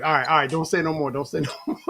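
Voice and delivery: ordinary delivery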